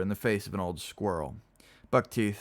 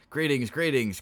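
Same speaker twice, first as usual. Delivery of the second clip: higher, louder hearty greeting; cried out; 6 semitones higher, 5 dB louder than usual. Acted. Dolt greeting the patients, crying out loudly. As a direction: call out the greeting with head thrown back. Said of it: "threw his head back and cried".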